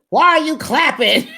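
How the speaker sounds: high, loud demand with a laugh in it; angry retort. Retold or imitated. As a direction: bark the question back. Jen snaps after hollering.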